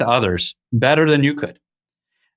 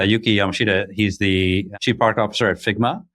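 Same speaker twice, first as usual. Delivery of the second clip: ordinary talk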